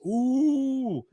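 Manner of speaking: long, high awed ooh; drawn-out high mock crowd ooh. Imitates crowd watching fatality.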